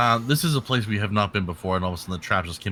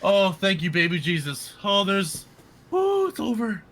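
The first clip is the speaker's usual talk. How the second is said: much higher relieved cry; 10 semitones higher than usual. Real, not acted. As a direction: gasp out in relief. Noise finally stops.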